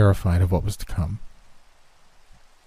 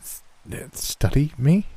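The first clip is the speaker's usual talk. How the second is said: surprised; 7 semitones higher, slower than usual. A narrator's reading. Shocked at being studied.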